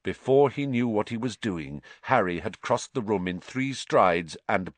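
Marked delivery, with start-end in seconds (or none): none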